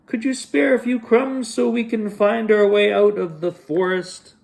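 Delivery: high, cutesy pleading voice; disguised kitty. Acted. Disguised Wally pleads with cookie in a high cutesy voice. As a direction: plead sweetly as a lost kitty.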